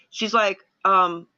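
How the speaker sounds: ordinary delivery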